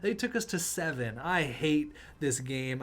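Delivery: ordinary delivery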